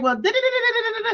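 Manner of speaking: high, sing-song da da da; mock rambling caller. Imitating caller's high babble. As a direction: parody her rambling. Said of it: "And she was like".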